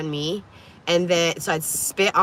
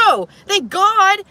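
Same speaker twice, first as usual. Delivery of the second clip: emphatic relief; 12 semitones higher, 6 dB louder, slower than usual. Retold or imitated. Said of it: "I was like".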